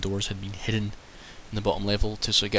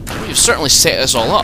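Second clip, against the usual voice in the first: high and loud, hammed-up villain line; mock grand voice; 7 semitones higher, 12 dB louder than usual. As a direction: belt it out in a high put-on voice. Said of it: "That was quite good".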